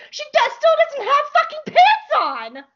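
high, loud incredulous squeal about the pants; outraged squeal. Real, not acted. Fan ranting to her viewers, high incredulous squeal.

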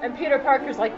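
normal talking voice

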